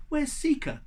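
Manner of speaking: high, urgent question; alarmed. Acted. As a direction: ask in sudden worry. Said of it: "Snowbot explained".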